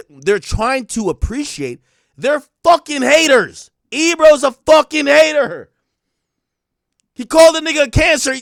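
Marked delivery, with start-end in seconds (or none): 2.2-8.3 heated rant. Real, not acted. Ranting at critics.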